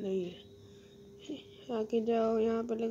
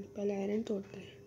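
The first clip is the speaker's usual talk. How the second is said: unvoiced, lower whisper; 4 semitones lower, 10 dB quieter than usual. Real, not acted. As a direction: breathe it conspiratorially. Whispered plan while mining.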